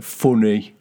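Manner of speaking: "Funny" with rounded "foo" vowel; own regional pronunciation. Shown as a demonstration. Showing friend how we say it, regional "Funny". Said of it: "We're like".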